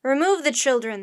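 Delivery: loud captain's command; roaring. A narrator's reading. Captain orders sailors to clear the children, roaring. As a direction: roar out an order. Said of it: "the captain roared again".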